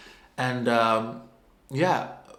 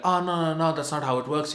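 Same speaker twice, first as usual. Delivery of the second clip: mock official refusal; 5 semitones higher, 3 dB louder than usual.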